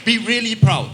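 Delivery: rally the room, leaning hard on "be really proud"; emphatic urging. Real, not acted. Speaker rallying Filipino audience to be proud, loud and emphatic.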